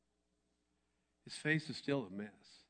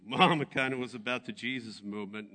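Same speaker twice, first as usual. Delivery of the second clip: ordinary talk, nothing marked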